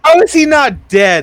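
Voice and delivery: high, loud comic outrage; exasperated high-pitched holler; incredulous shout. Real, not acted. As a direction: yell it in utter disbelief. Exclaims over boy in frozen pool.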